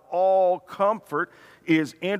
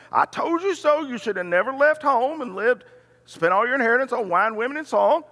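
high, raised-pitch parental rebuke; mock scolding; 7 semitones higher, faster than usual. What father didn't say to prodigal son, mock scolding. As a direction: berate the wayward son in a hammed-up telling-off.

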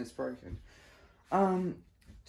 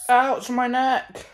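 loud, higher, drawn-out ouch; pained cry; 5 semitones higher, 8 dB louder, slower than usual. Real, not acted. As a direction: cry out at a sudden pain. Reacting to a neck pain.

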